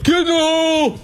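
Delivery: high-pitched imitation of a yelling fan; mock golf-heckler yell. Co-host mimics golf fans who shout at every shot, mock yell. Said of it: "anybody that yells".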